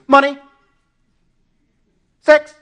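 high, loud one-word blurt; abrupt mock-excited outburst; sudden distraction. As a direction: blurt it as if something caught your eye. Acts sudden distractions, echoing the dog.

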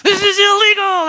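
high, loud outraged yell at the couple; retold scream. Judge screaming at the converted couple, furious yell. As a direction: shout 'Why would you do this?' as an angry judge. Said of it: "He screamed".

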